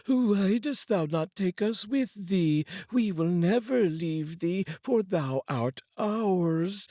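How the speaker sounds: haunting spirits who won't let go; eerie unlucky Days. Acted. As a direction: spirits taunting the man they cling to.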